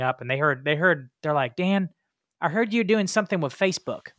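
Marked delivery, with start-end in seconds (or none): 1.6-4.1 mimicking a Southern neighbor's Southern accent, higher and louder. Locals approaching Dan about Facebook, in a Southern accent. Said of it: "more of a Southern accent".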